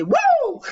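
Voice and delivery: high whooping cheer to lead the crowd; call-out woo. Shown as a demonstration. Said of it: "everybody say".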